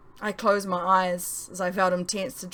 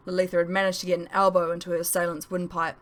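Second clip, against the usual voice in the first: usual speaking voice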